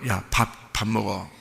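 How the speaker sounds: put-on soft coaxing, pleading with a child to eat; coaxing parent. Parent coaxing a fasting child to eat, softly. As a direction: hush and coax a hungry child. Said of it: "그러면 뭐라 그러면".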